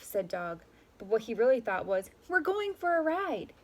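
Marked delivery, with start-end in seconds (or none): 2.3-3.5 high, slow and thrilled; excited. A narrator's reading. Dog thinks happily about the ride, excited. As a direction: savor the joy of the ride.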